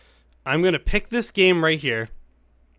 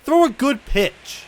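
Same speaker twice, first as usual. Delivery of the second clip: exasperated plea; 10 semitones higher, 4 dB louder, slower than usual. Real, not acted. Scolding pitcher again.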